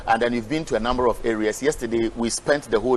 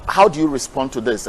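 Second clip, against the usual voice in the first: ordinary delivery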